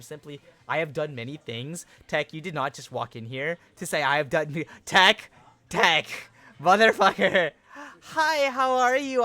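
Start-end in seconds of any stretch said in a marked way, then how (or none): 4.8-7.5 loud indignant call-out. Real, not acted. Calling out a chatter. 8.0-9.2 high, slow, saccharine greeting; fake-polite before snapping; sarcastic sweetness. Real, not acted. Sarcastic greeting after yelling.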